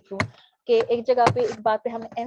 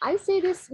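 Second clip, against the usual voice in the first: ordinary talk, nothing marked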